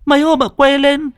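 high-pitched, stammering panic; horrified. A narrator's reading. Scared roommate explains to the neighbour, high panicked stammer. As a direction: gabble it out in tearful-sounding fright. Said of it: "Hằng kinh hãi".

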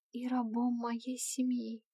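slow, hushed and despairing; desperate whisper. A narrator's reading. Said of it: "отчаянно прошептала Альба".

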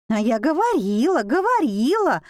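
high, swooping lament; wailing. A narrator's reading. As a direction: wail it out in a singsong complaint. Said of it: "завыла из угла Аська".

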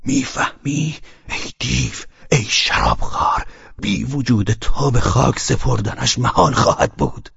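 strained, seething reproach; suffocating anger at a drunkard; choked with rage. A narrator's reading. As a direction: force the words out through choking fury.

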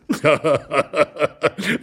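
wheezy fit of acted laughter; old man's laughter. A narrator's reading. Old man laughing at his wife's side, helpless. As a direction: laugh yourself into a cough. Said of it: "wandte sich lachend und hustend der Alte".

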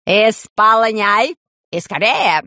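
slow, loud barked command; shouted order. Acted. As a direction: bark the order slowly. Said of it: "высунул оттуда нос и крикнул".

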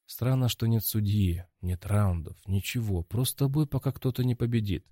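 plain reading voice

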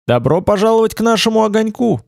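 calling out loudly to guests; loud welcome. A narrator's reading. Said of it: "пригласил Олег громко".

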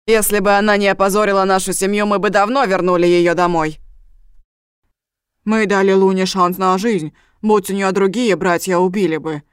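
loud, heated accusation; angry justification. A narrator's reading. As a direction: drive it out forcefully, defending family honour. Brother angrily defends family honour. (громко, с гневом)

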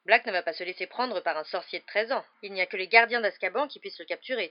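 tense insistence. A narrator's reading. Father to son about an escaped prisoner, tense insistence. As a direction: insist firmly, under strain. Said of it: "qui paraissait très tendu".

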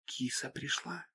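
high, slow, barely audible whisper. Acted. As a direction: breathe it almost silently. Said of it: "едва слышно прошептала".

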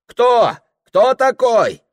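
high, very loud demand; loud challenge. Acted. Host challenging guests at the feast, shouting. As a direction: fire the question across the room.

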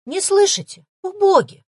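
high, loud flustered wail; alarmed wailing. A narrator's reading. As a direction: wail it in sudden panic. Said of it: "всполошено запричитала".

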